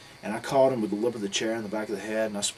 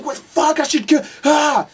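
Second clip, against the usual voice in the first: high, loud enraged sputtering yell; full-volume retold fury; furious shouting; 14 dB louder, slower than usual. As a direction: imitate someone raging, drawing out the roars. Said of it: "he was just furious about everything".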